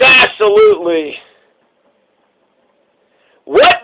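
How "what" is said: sudden high-pitched "What?"; shocked cry. A narrator's reading. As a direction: jump up in shock. Man shocked by Death.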